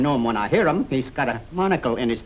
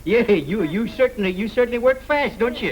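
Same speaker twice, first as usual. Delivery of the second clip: teasing; 6 semitones higher than usual. Acted. Teasing laugh at fast proposal.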